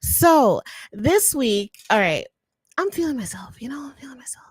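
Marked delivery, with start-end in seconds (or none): 2.8-4.5 breathy, teasing whisper to herself; playful whisper. Pretend. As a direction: whisper it like a cheeky secret. Bragging about herself.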